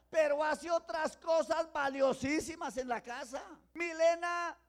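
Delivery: slow, loud emphatic proclaiming; emphatic preaching. Acted. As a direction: stress each word for the church. (proclamando lento y fuerte)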